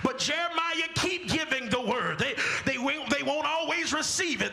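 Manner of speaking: high, driving, fast preaching climb; fervent preaching. Acted. Preacher building to a climax for the congregation, fervent.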